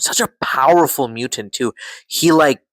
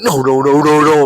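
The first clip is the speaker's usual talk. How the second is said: louder pleading no's; yelling at the TV; 5 dB louder than usual. Retold or imitated. Fan recalling screaming at the TV, louder pleading no's. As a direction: beg the screen to stop it. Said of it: "I'm screaming at the T V".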